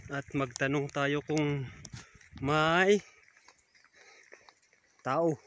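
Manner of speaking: ordinary delivery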